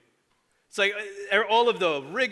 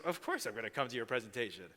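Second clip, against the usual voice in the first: low, soft reassuring promise; tender promise; 7 semitones lower, 11 dB quieter than usual. Retold or imitated. Mentor promising a boy he'll come, soft and low. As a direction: gently reassure a child.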